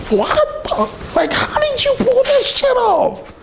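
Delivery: high, slow mock squeal of disbelief; freaking-out brother. Brother watching from the next car, hammed-up freak-out. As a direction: imitate him losing it in amazement. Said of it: "he was freaking the fuck out".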